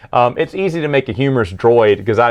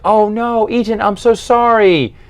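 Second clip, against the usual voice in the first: mock female voice; 10 semitones higher than usual. Singer's shocked apology, voiced by reviewer.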